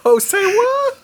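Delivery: high, loud "Hosea what"; excited question. Real, not acted. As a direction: pitch up in delighted disbelief. Excited guessing.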